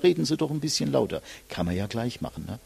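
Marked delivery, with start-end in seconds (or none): 0.0-1.2 a lightly voiced grandpa grumbling "Reden Sie doch ein bisschen lauter", quick; hint of a grumpy old man calling from the back row. Coach imitating a grandpa in the back, lightly grumbling. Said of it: "irgendein Opa hinten auf und sagt".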